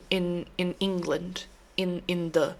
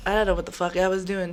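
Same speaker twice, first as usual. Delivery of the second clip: ordinary talk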